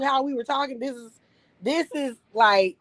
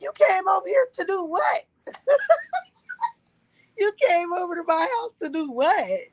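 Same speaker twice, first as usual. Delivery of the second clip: incredulous; 8 semitones higher than usual. Retold or imitated. Incredulous at dad moving in.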